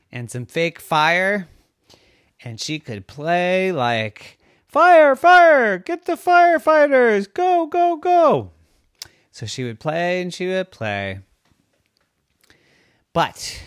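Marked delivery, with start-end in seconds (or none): 4.7-8.5 put-on high, loud little girl calling alarm; excited shouting. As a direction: yell it like a kid at play. Girl plays firefighter, calling out.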